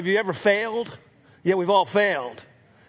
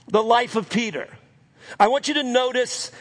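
ordinary delivery

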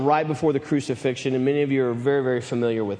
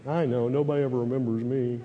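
Bartholomew, forgotten. Acted. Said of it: "Hmm, Bartholomew".

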